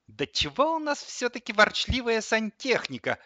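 high-voiced indignant coffee grinder; fed-up outburst. A narrator's reading. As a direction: burst out as if unable to hold back. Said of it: "не выдержала кофемолка". (не выдержав, высоким голосом)

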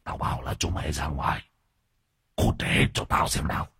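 low, hushed ghostly growl; possessed father growling at his crying children. A narrator's reading. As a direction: snarl it under the breath like a demon. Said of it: "anh Tiếp gầm gừ".

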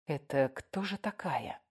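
hushed, slow musing to oneself; near-whispered puzzlement; puzzled whisper. Acted. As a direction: wonder under your breath, drawn out. Silent wondering about unknown author.

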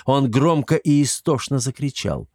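ordinary delivery

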